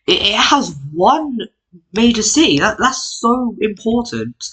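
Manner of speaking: high, mock-overwhelmed wail; hammed-up weepy awe; mock tearful. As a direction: pretend to choke up, voice pitched high. Mock emotion over one city.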